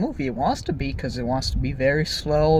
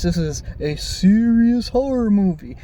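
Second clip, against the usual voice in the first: oversell how serious it supposedly is; mock-serious emphasis; 3 semitones higher, 4 dB louder than usual. Mocks the film's seriousness.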